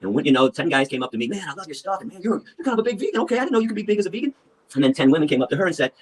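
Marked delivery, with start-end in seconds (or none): 1.3-4.3 high-pitched gushing fan voice; put-on high voice, impressed; admiring fan. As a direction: imitate an awed fan coming up to compliment you. Male fans admiring him, voiced.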